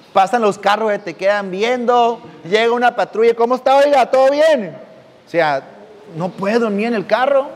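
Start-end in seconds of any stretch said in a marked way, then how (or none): 3.3-4.8 policeman checking in. Retold or imitated. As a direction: play the officer knocking on the window. Patrol officer questions him.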